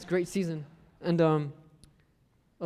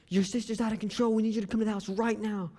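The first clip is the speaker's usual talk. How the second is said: imitating a frantic parent, higher pitch; a parent's urgent plea, pitched up; higher-pitched mock panic; 5 semitones higher than usual. Parents urgently phone him for help.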